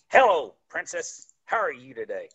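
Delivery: lightly imitated distinctive old-time entertainer. Entertainer greets a six-year-old girl backstage, lightly imitated distinctive voice. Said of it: "he had a very distinct voice".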